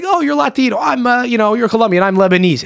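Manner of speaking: high-pitched, excited impression of someone claiming kinship; overeager stranger. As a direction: imitate a keen stranger, high and loud.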